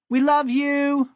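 very high, slow adoring call; high-pitched, drawn-out cheer. A narrator's reading. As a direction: call it out like a squeaky fan bird. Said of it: "one bird called out".

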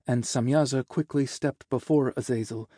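plain reading voice

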